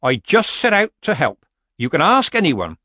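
injured innocence. Acted. Granny defends herself before the witches, protesting innocence.

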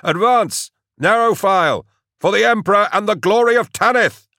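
very high, loud battle orders; ringing, rousing war cry; shouted command. A narrator's reading. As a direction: shout down a tunnel to the troops. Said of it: "turned to shout down the chute".

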